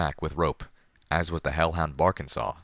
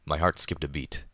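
ordinary delivery

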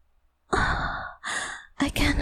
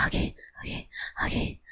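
whispered; 11 dB quieter, much faster than usual. Real, not acted. Calming herself down.

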